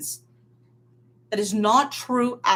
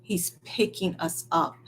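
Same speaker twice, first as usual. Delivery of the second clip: usual speaking voice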